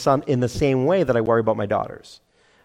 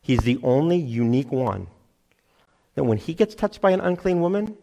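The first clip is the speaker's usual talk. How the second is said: usual speaking voice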